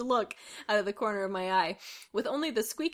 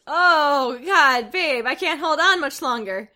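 louder, hammed-up mock moan; 4 semitones higher, 9 dB louder, slower than usual. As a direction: groan the words like a cheesy porn actor. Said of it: "then moaned".

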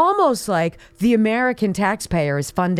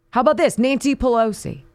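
usual speaking voice

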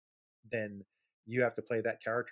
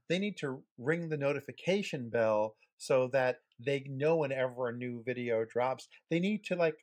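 higher, slow, emphatic YouTuber pitch; hyped channel plug; 5 semitones higher, 3 dB louder than usual. Pretend. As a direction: sell the subscribe button hard. Hyped call to subscribe.

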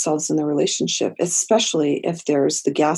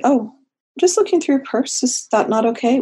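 mock innocence; 7 semitones higher than usual. Therapist feigns innocence rummaging purse.

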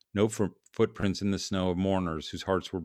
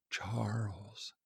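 very soft ghostly whisper; otherworldly whisper; 10 dB quieter than usual. A narrator's reading. Ghost calls the dreamer by name, otherworldly whisper. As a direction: breathe it like a phantom calling. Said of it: "and then the otherworldly whisper".

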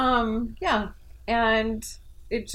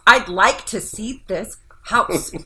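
slow, drawn-out mock whine, louder; whiny reading; 6 dB louder, much slower than usual. Imitating how a client's text sounds to her, whiny. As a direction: drag each word out in a whine. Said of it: "They aren't saying it like that to me".

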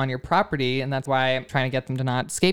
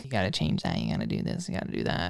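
low, soft, gruff muttering of bossy orders; mock bossy bride; 7 dB quieter, faster than usual. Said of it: "I think you would be like".

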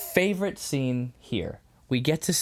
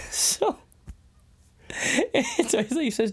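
ordinary delivery

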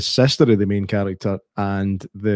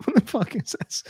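baffled; 11 semitones higher, 5 dB quieter than usual. Retold or imitated. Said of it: "I was like".

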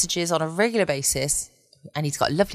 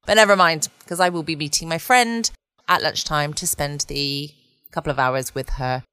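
ordinary talk

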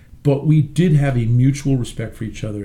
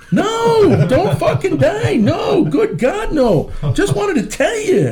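put-on much higher, laughing, gushing old doctor; old doctor calling a patient, high-pitched and overjoyed; slower than usual. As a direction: rave about the CD in a squeaky old-man voice. Doctor reassures he's not dying.

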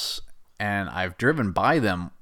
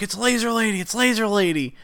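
put-on higher, gushing kid voice; mock excited kids; 10 semitones higher than usual. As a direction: squeal it like thrilled children. Kids voiced recognizing her.

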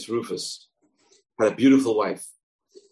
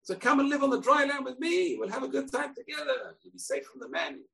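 high, sweet tempting voice that fades; the fox luring the fish onto dry land; coaxing fox; 4 dB quieter than usual. Acted. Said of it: "the fox tells the fish".

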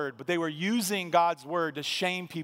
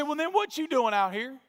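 much higher, challenging demand; scoffing preacher; 8 semitones higher than usual. Retold or imitated. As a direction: jab the question at him. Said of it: "He said".

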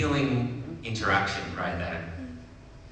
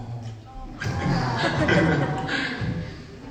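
real laugh, higher pitched; 5 semitones higher than usual. Real, not acted. Reader finishes a moving story, real laugh. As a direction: burst into laughter.

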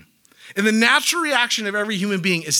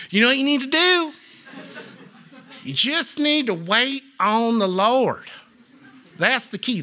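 mocking pious advice; 5 semitones higher than usual.

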